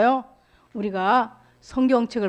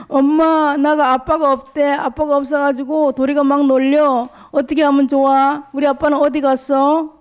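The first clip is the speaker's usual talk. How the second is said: put-on upset child; 6 semitones higher, 3 dB louder than usual. Sora complains to mother.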